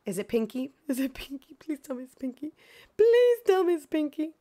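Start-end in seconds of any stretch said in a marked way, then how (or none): 1.1-2.5 soft, hushed begging; quiet plea. Real, not acted. Streamer hoping for Pinky, soft hushed plea. 3.0-4.3 high, loud begging; eager plea. Real, not acted. Streamer hoping for Pinky, loud high begging.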